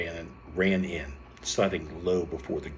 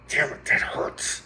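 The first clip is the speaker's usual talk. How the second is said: loud, slow growl of pain; acted growling outburst; 10 semitones higher, 5 dB louder, slower than usual. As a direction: growl it out, drawn out and rough. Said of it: "growled as she brought forth her namesake".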